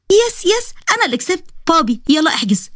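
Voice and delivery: very high, excited cheer; girlish high-pitched gloating; gleeful triumph. Acted. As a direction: celebrate winning the argument. Winning the argument, cheering.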